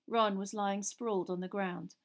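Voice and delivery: ordinary delivery, nothing marked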